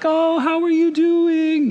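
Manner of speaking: high, slow, sing-song hello; sweet phone greeting. Retold or imitated. Husband imagining a FaceTime call home, high and sweet. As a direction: coo it like calling home to family. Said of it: "FaceTime my family and be like".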